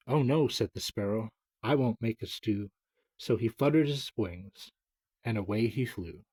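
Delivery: nursery rhyme reading. Acted. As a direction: recite it like a children's poem. Reads nursery rhyme aloud.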